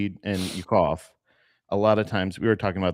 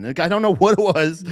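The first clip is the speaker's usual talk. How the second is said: usual speaking voice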